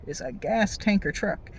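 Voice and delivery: ordinary talk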